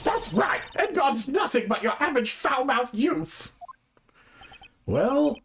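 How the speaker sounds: put-on high-pitched, indignant old bag; high old-lady voice, conspiratorial; gossipy old lady witness.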